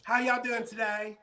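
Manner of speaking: high Southern singsong hello; Southern greeting. Pretend. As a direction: greet customers in a put-on Southern twang. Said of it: "I used to do that".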